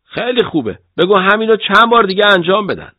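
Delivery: high-pitched, loud teasing; joking. A narrator's reading. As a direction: toss it out as a loud joke, pitched well up. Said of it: "بعد به شوخی گفتم". (با شوخی و صدای بلند)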